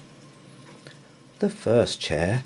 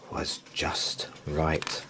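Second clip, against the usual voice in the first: usual speaking voice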